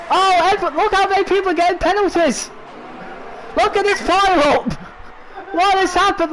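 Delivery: high, urgent play-by-play; excited commentary. Real, not acted. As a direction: call the chaos breathlessly. Pile-up commentary.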